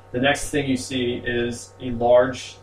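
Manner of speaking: usual speaking voice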